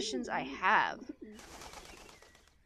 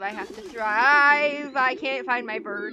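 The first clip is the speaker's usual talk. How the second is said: dramatic declaration; 3 semitones higher, 13 dB louder, slower than usual. Acted. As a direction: stretch it out like an epic vow. Game hype while eating.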